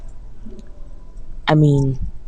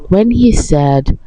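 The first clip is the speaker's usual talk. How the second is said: ordinary delivery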